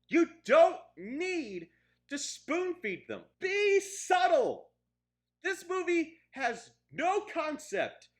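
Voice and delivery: loud, high, slow, drawn-out words; slow it down, still furious; slow angry rant. Real, not acted. Continuing rant, slower.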